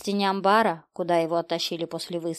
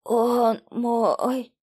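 higher, broken, effortful croak; dying wheeze; 6 semitones higher, 4 dB louder than usual. A narrator's reading. Dying policeman to the detective, strained high wheeze. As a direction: struggle to get each word out. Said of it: "хрипел мужчина".

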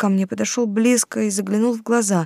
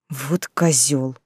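lower, slow muttered curse; blurted; 7 semitones lower, 3 dB quieter, much slower than usual. A narrator's reading. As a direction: let the insult slip out. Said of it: "вырвалось у меня". (вырвалось сквозь зубы)

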